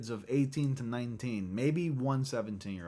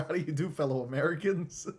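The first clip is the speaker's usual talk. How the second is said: higher, cheery imposter greeting; meme caption; 5 semitones higher than usual. Retold or imitated. Reading another meme caption, mock cheery imposter. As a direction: greet them like a disguised outsider.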